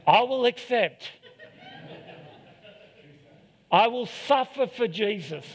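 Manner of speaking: laughing mock-solemn vow; mock heroic pledge. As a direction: play the noble sufferer, over the top.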